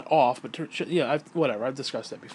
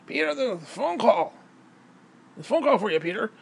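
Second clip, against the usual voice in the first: lightly higher announcing voice; imitating the neighbour announcing a call; old woman's call; 6 semitones higher than usual.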